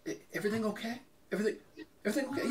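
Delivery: high, very soft tentative question; small hesitant voice checking on passengers; puzzled driver. Retold or imitated. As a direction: timidly imitate a concerned driver.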